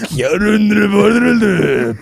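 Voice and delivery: loud, slow, high mock Korean announcer. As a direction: belt it out like a hammy Korean ad. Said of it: "moves into the Korean market".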